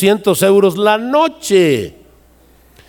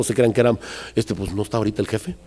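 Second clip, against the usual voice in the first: "pues no está ahorita el jefe" said much softer and fast; quiet muttered excuse; 8 semitones lower, 8 dB quieter, faster than usual. Acted. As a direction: mumble the excuse quickly under your breath. Discípulo excusándose en voz baja. (excusa murmurada en voz baja y rápida)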